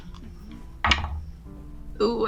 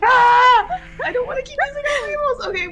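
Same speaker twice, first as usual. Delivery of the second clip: hyperventilating; 12 semitones higher, 4 dB louder than usual. Real, not acted. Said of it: "I'm panicking".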